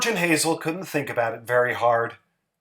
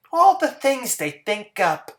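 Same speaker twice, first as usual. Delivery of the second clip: higher, sweet and simple housewife voice; Hazel's voice; 9 semitones higher than usual. Acted. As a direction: voice Hazel a bit higher, artless and pleased. Hazel's voice in story.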